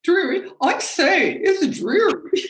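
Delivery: high, hammed-up British "Dreary? Dreary?"; mock British. Imitating her British husband, in a hammed-up British accent. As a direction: play the plummy Brit, pitched well up. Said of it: "So that sounds very British".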